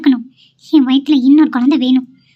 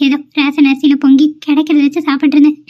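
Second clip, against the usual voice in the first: ordinary delivery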